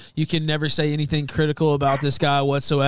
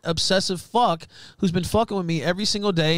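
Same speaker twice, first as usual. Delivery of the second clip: normal talking voice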